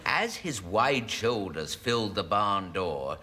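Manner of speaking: low, measured novel reading; story narration. Acted. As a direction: read it as overblown rustic romance. Reads the novel aloud.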